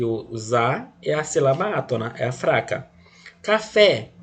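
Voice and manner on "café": "Café" with "fé" lifted high; "Café" stressed on the last syllable. Shown as a demonstration. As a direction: hit "fé" in "Café". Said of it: "o fé é a tônica".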